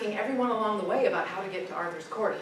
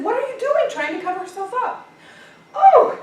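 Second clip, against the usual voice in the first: high, loud startled shriek; a lady caught off guard, crying out; 12 semitones higher, 9 dB louder than usual. A narrator's reading.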